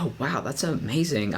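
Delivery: ordinary talk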